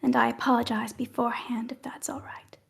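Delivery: soft unvoiced apology; hushed apology to the party; whispered apology. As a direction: whisper the sorry.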